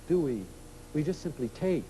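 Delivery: normal talking voice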